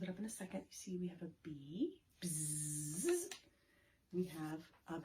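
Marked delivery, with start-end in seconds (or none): 2.2-3.3 a short imitated bee buzz. Naming the bee.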